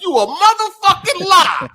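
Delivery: high, loud comic outburst of disbelief; outraged mock yell. Retelling a kid calling out mom's Santa fib, mock outraged yell. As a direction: burst out with a shrill, hammed-up accusation. Child's retort to mom, retold.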